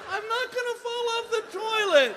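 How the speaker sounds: high, loud indignant protest; hammed-up protest, high and loud. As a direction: insist with mock outrage. Arguing with nurse.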